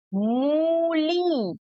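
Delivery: "मूली" with both vowels heavily stretched; long ऊ and ई. Shown as a demonstration. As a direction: draw out "मूली" slowly as moooo-leee. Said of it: "थोड़ा सा हमें जोर लगाकर".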